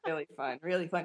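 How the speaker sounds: normal talking voice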